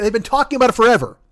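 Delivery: usual speaking voice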